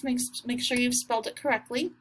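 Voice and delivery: ordinary talk, nothing marked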